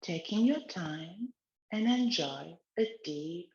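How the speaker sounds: slow, soothing exercise guidance; guided relaxation. Acted. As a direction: lead gently, eyes closed. Leads a guided neck exercise.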